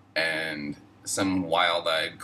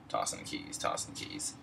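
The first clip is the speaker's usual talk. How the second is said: muttered taunt; 11 semitones lower, 10 dB quieter than usual. Acted. Muttered taunt while walking by.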